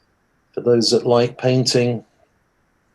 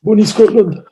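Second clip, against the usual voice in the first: hammed-up Scottish accent, higher and louder; a bad mock Scottish accent, rolled out with gusto; poor Scottish accent; 8 semitones higher, 6 dB louder than usual. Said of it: "Poor attempt at a Scottish accent".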